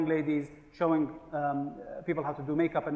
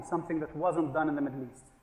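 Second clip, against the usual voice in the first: ordinary talk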